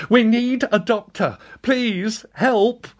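high, pleading cry; desperate plea. Acted. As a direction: beg for help in panic. Woman with injured child.

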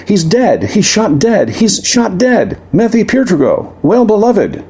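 high, panicked lament; hysterical wail. Acted. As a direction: shriek the news in horror. Minnie hysterical over the death.

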